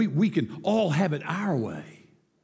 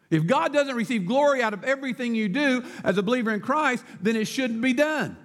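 higher, projected preaching; emphatic restatement; 8 semitones higher than usual. Real, not acted. Preacher restating to a hesitant congregation, emphatic. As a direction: drive the point home firmly, phrase by phrase. Restates after listeners seem not to agree.

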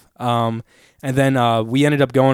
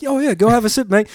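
put-on British accent; 9 semitones higher than usual. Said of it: "British accents".

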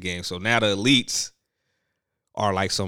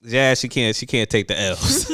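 usual speaking voice

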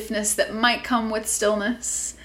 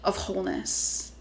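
guided meditation; 5 dB quieter than usual. Acted. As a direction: gently guide them inward.

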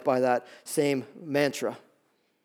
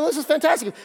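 much higher mock delight; delighted parents; 10 semitones higher than usual. Parents reacting to Samson's honey, high mock delight. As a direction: gush like naive parents. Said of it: "They're thinking".

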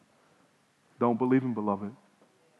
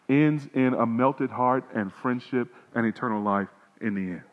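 usual speaking voice

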